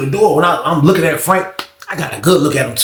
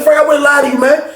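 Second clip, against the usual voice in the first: heated plea; 10 semitones higher than usual. Retold or imitated. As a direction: still shouting "Frank, I wouldn't lie to you". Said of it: "I tried to slow it down".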